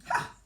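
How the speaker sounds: sharp scornful ha; scornful Queen's exclamation. A narrator's reading. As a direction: bark a scornful ha. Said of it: "said the Queen".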